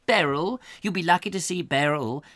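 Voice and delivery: a hint of a snooty old lady; lightly voiced prim gossip; snobbish neighbour. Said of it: "I've got to get out of character".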